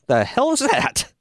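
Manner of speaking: high, drawn-out mock disbelief; baffled reaction. Podcaster reacting to a wrestler's odd accent, high baffled question. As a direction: react bewildered, pitch jumping well up. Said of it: "I'm like".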